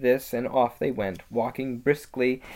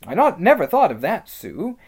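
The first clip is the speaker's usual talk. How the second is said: pleasantly surprised; 7 semitones higher, 8 dB louder than usual. Acted. Peter struck by Susan's idea.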